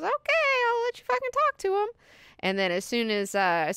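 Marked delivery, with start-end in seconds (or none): 0.0-1.9 very high put-on voice; mock grudging girl. Girl gives in to the hypnotist, high mock reluctance. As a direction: give in with a squeaky imitated girl voice.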